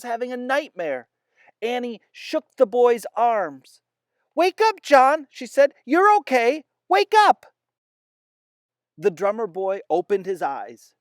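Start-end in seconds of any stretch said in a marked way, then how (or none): 4.3-5.3 high, loud urgent wake-up call. Acted. Girl shaking a dreaming soldier awake, high loud urgent call. Annie wakes boy from nightmare. 5.8-7.5 very high, loud urgent plea; urgent pleading. Acted. Girl pleading with dreaming drummer boy, very high loud urgent plea. Annie wakes boy from nightmare.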